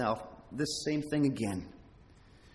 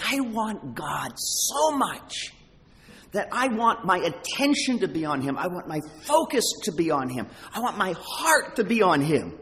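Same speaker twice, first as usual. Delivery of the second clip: building longing; 6 semitones higher, 3 dB louder than usual. Retold or imitated. Preacher voicing the heart's longing for God, building fervently.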